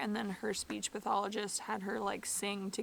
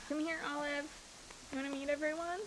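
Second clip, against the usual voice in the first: talking to a dog; 5 semitones higher, 6 dB quieter than usual. Real, not acted. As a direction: coo at the dog sweetly.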